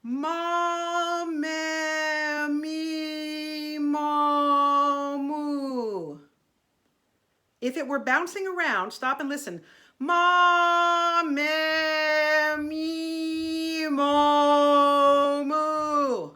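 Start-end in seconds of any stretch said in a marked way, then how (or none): 0.0-6.2 sustained vowel drill. Shown as a demonstration. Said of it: "Try it, here we go". 10.0-16.3 vowels held long with the placement jumping from vowel to vowel; bouncing placement. Shown as a demonstration. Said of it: "placement bouncing all around".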